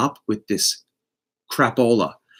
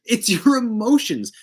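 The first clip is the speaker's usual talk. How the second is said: high, punchy and loud on do you; sassy comeback; 10 semitones higher, 5 dB louder than usual. Pretend. Reader answering clients who beg the cards, sassy and loud. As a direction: toss it off with full sass.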